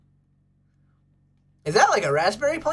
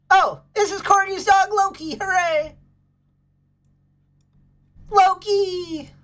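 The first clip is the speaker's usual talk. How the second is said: much higher, louder cheer for Loki; excited discovery; 10 semitones higher, 4 dB louder than usual. Real, not acted. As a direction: greet the dog like a long-lost friend. Finding Corgy's dog.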